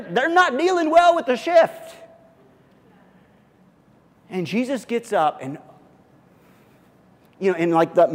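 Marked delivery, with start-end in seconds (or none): 0.0-2.0 excited. Real, not acted. Pitch jumps describing panic.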